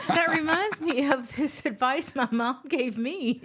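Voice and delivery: laughing while speaking. Retold or imitated. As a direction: quote broken by laughter. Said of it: "You, you can hardly get it out without".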